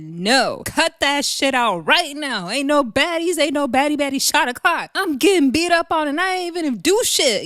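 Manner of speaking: high, fed-up mock rant; imitating Rolly. Voicing Rolly telling Natalie off, high indignant rant. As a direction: vent it like you got jumped for nothing. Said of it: "Rolly said".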